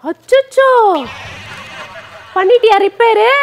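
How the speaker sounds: loud, high-pitched exclamation; alarmed dismay. Acted. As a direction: cry out in alarm at the damage. Finds the phone broken.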